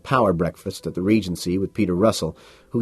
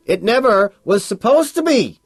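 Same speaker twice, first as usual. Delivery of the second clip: much higher, loud bellow; 14 semitones higher, 6 dB louder than usual. Acted. Bateman bellows at Carnes in the club. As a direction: roar it at him. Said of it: "I bellow".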